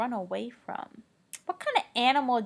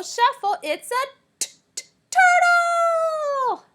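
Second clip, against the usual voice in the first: much higher, louder, very slow stretch; excited reveal; 10 dB louder, slower than usual. Pretend. Teacher reveals the turtle to the class. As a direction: burst out the answer in triumph.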